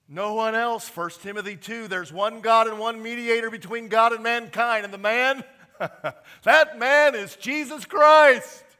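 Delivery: loud, high, fiery preaching; preaching at full force. Real, not acted. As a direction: shout it out to the congregation.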